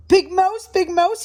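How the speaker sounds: high, urgent small voice; little mouse calling. A narrator's reading. Little Mouse calling Big Mouse about noise, high call. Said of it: "he called".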